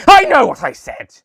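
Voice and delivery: high, loud outburst; the Master yelling at the interrupting Cyberman; furious yell. A narrator's reading. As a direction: shout him down in a rage. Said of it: "the master yelled".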